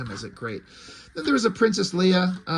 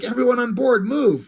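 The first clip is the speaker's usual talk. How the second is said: urgent order; 7 semitones higher, 5 dB louder than usual. Retold or imitated. Han orders escape under fire.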